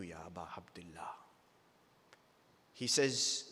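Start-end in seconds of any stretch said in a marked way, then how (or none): 0.0-1.2 breathy whisper, barely voiced. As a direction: let the name fall to a grieving whisper. Hasan weeping for Hussein.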